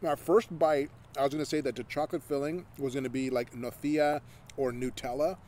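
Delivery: talking while chewing; speaking through food; mouth full. Real, not acted. Seen 0: Left speaker bit cookie at -4s; talks with chocolate-smeared, working mouth.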